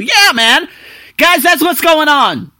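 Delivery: high, loud and slow; hyped. Real, not acted. Vlogger hyping his audience, excited. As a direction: pump up the listeners.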